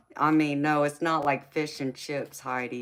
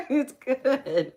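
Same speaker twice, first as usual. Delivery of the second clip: laughing; 11 semitones higher, 6 dB louder than usual. Real, not acted. Laughing insistence.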